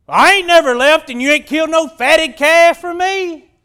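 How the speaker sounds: loud, high, aggrieved shout; mad. Acted. Older brother complaining about the fatted calf, mad shouting. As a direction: shout the grievance like a jealous brother. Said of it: "He's mad 'cause his brother's been found".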